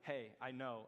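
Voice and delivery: low, ominous "Hey, I know"; a low, teasing threat; creepy knowing voice. Retold or imitated. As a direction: drop the voice and make it sound like you know their secrets.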